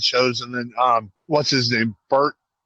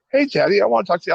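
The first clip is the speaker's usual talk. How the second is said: put-on higher, eager kid voice; imitating a kid; 8 semitones higher, much faster than usual. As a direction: play a pleading child, pitched up. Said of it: "the kid's like".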